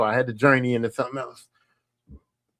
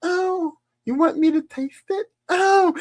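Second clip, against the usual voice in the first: breathy mock moan; 13 semitones higher, slower than usual. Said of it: "it's like".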